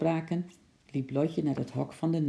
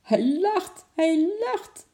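high, slow, delighted cry; excited calling out; 14 semitones higher, 5 dB louder, slower than usual. A narrator's reading. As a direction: call the amazing news across to father. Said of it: "riep Lotje naar vader en oom Nijltje". (opgetogen uitroepen)

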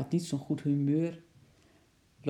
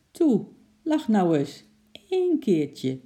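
high, louder little plea; coaxing plea; 6 semitones higher, 6 dB louder than usual. A narrator's reading. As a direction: coax someone into it. Child coaxes rhino to smile. (smekend aanmoedigen)

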